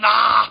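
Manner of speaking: loud strained "aah"; mock Arnold yell. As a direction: burst out in a muscleman's groan.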